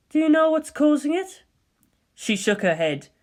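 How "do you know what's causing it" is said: composed, higher enquiry; calm colleague asking; Ritsuko's voice. Acted. Ritsuko asking Misato the cause, composed and higher.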